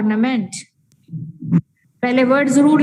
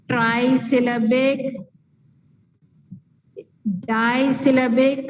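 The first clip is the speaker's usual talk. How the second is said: slow careful terms; much slower than usual. Shown as a demonstration. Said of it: "ऐसा भी लिख सकते हैं".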